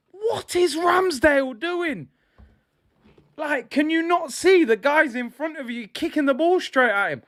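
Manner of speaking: real fan fury at a sloppy pass; exasperated shout. Real, not acted. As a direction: yell at the keeper as if he could hear. Berating the keeper, shouting.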